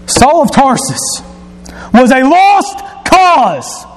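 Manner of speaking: high, emphatic preacher delivery; fervent, stretched-out sermon emphasis; impassioned preaching. Acted. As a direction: declare it from the pulpit with high-pitched force.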